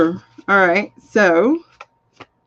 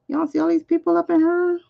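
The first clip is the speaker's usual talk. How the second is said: hyped crowd callout; 9 semitones higher than usual. Pretend. Repeats it higher, hyping crowd.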